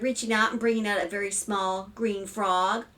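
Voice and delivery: usual speaking voice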